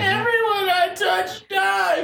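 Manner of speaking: high, slow, sung-out shout; melodramatic wail. Retold or imitated. A friend echoes the brooding boyfriend, wailing high. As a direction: belt it like a tragic hero.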